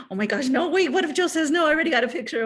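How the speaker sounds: high, loud, flustered rush; mock panic. Worrying to her husband that Joe says no, flustered high panic. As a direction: fret out loud that he'll say no.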